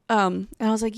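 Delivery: normal talking voice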